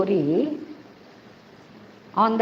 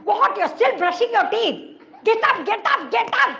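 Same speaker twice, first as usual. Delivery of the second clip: high, hectoring mother voice; comic scolding, pitched way up; shouting mummy; 12 semitones higher than usual. Acted. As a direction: nag the child through the morning routine. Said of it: "mummy shouting like this".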